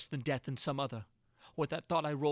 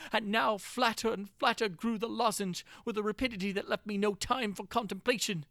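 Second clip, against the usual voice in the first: plain reading voice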